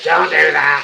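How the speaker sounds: loud high mock telling-off; mock scold. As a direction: shout it like a viewer scolding you. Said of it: "before anyone says".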